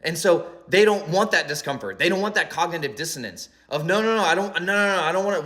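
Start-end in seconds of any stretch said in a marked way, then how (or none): 3.9-5.4 higher, rapid-fire mock protest; acted refusal. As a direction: wave it away as an unwilling listener. Cave dweller refusing the truth.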